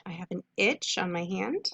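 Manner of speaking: usual speaking voice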